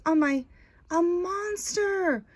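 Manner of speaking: put-on high, slow, scared boy's cry of alarm; frightened cry. A narrator's reading. Boy Pablo crying out at the monster, high and frightened. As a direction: gasp it out as a terrified boy. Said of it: "cried Pablo".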